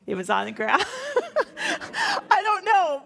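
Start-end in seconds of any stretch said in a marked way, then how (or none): 1.0-3.0 high, slow laughing I don't know. Real, not acted. Teacher laughing at Cain's fruit offering, high giggle. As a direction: giggle and trail off amused.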